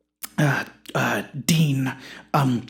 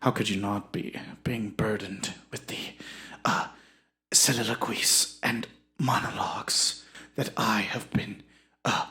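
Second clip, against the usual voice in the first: grandiose actor, fading to a whisper; 5 semitones lower, 7 dB quieter than usual. Acted. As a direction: introduce yourself with hushed theatrical self-importance.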